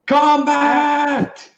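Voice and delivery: high, loud drawn-out call; in-character battle yell. Acted. Character warning everyone of wolves, high yell. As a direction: shout the alarm to the whole camp. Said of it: "he yells out to everyone".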